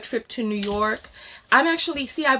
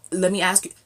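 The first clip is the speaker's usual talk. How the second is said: usual speaking voice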